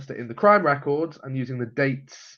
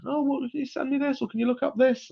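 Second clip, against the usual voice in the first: high-pitched mock pleading; put-on high, needy request; pestering relative; 12 semitones higher than usual. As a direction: imitate a relative asking favours in a much higher voice.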